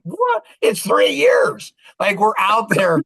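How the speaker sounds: high-pitched squawk of disbelief; hammed-up disbelief, loud by the end; incredulous. Reacting to co-author reading their book three years late, incredulous.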